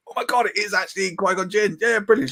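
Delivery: giddy imitation of his own reaction; retold excitement. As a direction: re-enact a thrilled moment of recognition. Retelling his thrilled reaction to missus.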